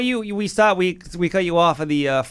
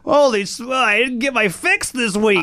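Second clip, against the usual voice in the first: high-pitched, hammed-up desperation; mock addict withdrawal; 6 semitones higher than usual. Co-host playing a podcast addict, mock desperation. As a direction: whine for a fix in jest. Plays addict missing a fix.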